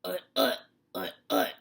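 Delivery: pig grunt. Acted. Reading to children, loud pig grunts.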